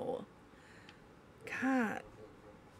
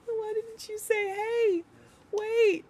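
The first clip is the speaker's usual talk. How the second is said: laughing; 10 semitones higher, slower than usual. Real, not acted. Laughing after mistake.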